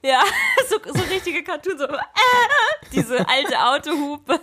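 talking through laughter; giggling high while speaking; laughing. Real, not acted. Laughing while joking about cartoon sounds.

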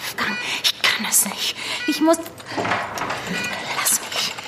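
hushed, desperate pleading; panicked whisper. Acted. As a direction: beg to be let go under your breath. Pia will panisch fliehen. (panisches Flüstern)

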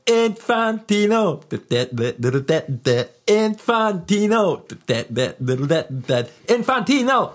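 put-on very high, drawn-out childish squeak; Infantino voice. Answering a friend's bit, as a high squeaky cartoon child. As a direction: stretch the name out like a cartoon kid.

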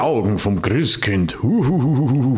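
slow, ominous parody with ghostly hooting; mock spooky. Co-host teasing that everyone will fear the Christkind, mock spooky. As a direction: tease with a haunted-house wail. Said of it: "dass alle Angst vorm Christkind haben".

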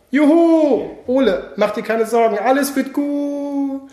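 high cheer, then slow soothing; cheer, then comfort slowly; joyful farewell. Acted. Peter chosen, comforts Ole.

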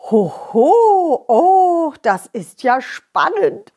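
excited whispered Santa; thrilled kindly old Santa. Acted.

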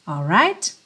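ordinary delivery

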